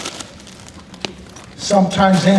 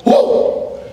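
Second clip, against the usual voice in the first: put-on girl's whoop. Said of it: "She goes".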